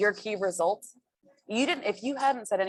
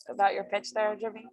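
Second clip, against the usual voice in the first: ordinary talk, nothing marked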